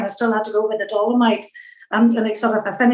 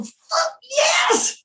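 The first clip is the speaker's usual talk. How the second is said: much higher, crying out in relief; triumphant yell. Retold or imitated. Exhausted swimmer hauling herself out of the water, yelling in relief. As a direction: let the relief burst out of you. Finally out of the swim.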